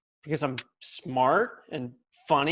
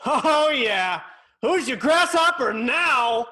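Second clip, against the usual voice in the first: louder, crowing victory cry; triumphant gloating; 6 semitones higher, 6 dB louder than usual. Acted. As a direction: rub it in like you just won. Boy gloats after grabbing pebble.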